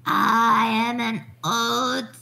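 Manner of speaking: put-on very slow, low old-woman voice. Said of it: "Can you do an old woman voice?".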